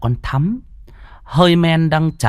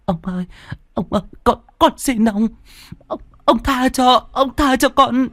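much higher, desperate begging in tears; tearful pleading; 14 semitones higher than usual. Acted. Thắm begging old Khâm to let her go, high tearful pleading. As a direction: beg for mercy, voice rising in panic. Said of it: "Nó nói trong nước mắt".

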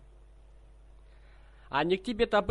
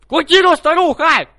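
much higher, much louder yell; 10 semitones higher, 13 dB louder than usual. Acted. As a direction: scream it at the old woman. Prankster yells at old woman.

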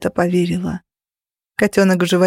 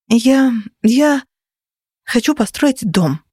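higher, halting start; hesitate, then let the wish out; breathed-out confession; 4 semitones higher, slower than usual. A narrator's reading. Heroine confesses she wants a house, haltingly. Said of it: "выдохнула я".

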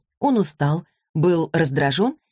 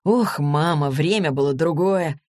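ordinary delivery

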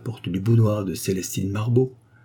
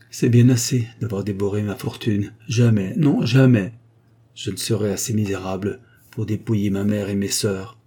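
plain reading voice

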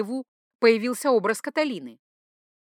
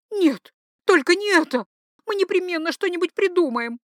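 high, loud protest from a frantic mistress; hot-tempered duchess, desperate; 10 semitones higher, 6 dB louder than usual. Acted. Duchess refuses to end the affair, high and desperate. Heroine's voice in narrated story.

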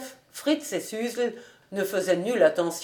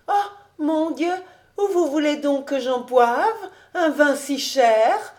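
put-on high-pitched girlish dismay; protesting young girl; 7 semitones higher, 4 dB louder than usual. A narrator's reading. Girl shocked at being offered costly wine, high protest. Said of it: "faisait-elle".

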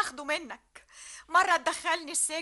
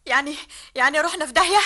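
alarmed question; 8 dB louder, faster than usual. Acted. Sabah realises they're finished.

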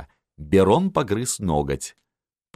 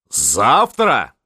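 louder, higher one-word command; shout; 4 semitones higher, 6 dB louder than usual. A narrator's reading. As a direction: call it out forcefully. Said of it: "крикнул Миних". (громкий выкрик)

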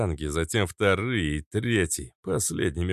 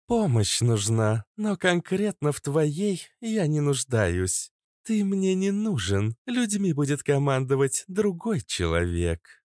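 cold, high female voice; icy and dismissive; 8 semitones higher than usual. A narrator's reading. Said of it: "холодно ответила Иштар".

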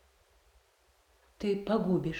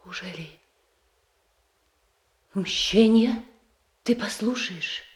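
pleading outcry; 4 semitones higher, 4 dB louder than usual. Acted. Sister confronts brother about revenge.

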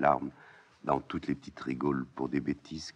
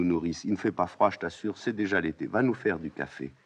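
ordinary delivery

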